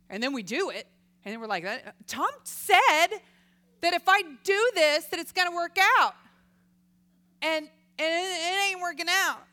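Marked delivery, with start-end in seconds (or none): 1.6-9.4 mock complaining victim.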